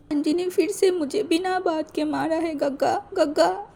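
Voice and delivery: put-on high, tearful child's voice pleading; crying little boy. Hurt boy crying to Gege about auntie's beating, high tearful plea. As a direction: sob it out like a hurt eleven-year-old begging. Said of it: "वो बहुत रो रहा था".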